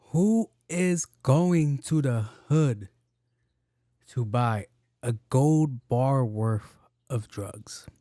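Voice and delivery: drawn out slowly, word by word; slow disbelief. Real, not acted. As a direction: stretch each word in disbelief. Mocking the movie's gold bars.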